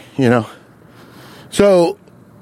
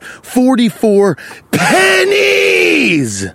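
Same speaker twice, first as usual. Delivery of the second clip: drawn-out, high, incredulous wail; incredulous slow build to a squeal; exasperated emphasis; 9 semitones higher, much slower than usual. Retold or imitated. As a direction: stretch the last word into a long high cry. Exasperated retelling of slow customer.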